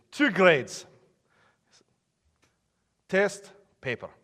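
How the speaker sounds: terse mock Russian professor; bad Russian accent. Professor explaining grading to struggling student, bad Russian accent. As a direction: fake Russian accent, doled out in fragments. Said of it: "don't like bad Russian accents".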